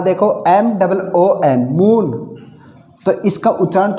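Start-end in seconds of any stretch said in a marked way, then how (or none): 1.7-2.1 मून with a long, drawn-out oo; मून said higher, vowel held long. Shown as a demonstration. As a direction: stretch the oo in मून. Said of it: "बड़ा होगा".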